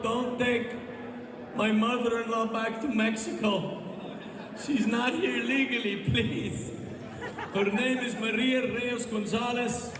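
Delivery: high, pleading fake sob in a Mexican accent; mock crying plea. A man begging Trump to deport his mother-in-law, high mock sob. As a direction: beg in a put-on Mexican accent, voice high and wailing. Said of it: "Mexican guys crying".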